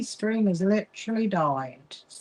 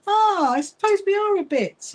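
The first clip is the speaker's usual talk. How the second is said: gasp of realization; 12 semitones higher, 3 dB louder than usual. Real, not acted. Gasps at a realization.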